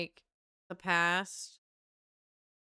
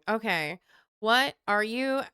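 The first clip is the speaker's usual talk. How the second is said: normal talking voice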